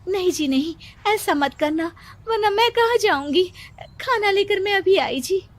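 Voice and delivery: high, anxious pleading to her husband; a frightened wife's high plea. Acted. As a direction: beg him not to remarry, voice up high. Wife begs angry husband.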